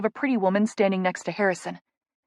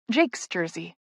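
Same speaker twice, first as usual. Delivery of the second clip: ordinary delivery, nothing marked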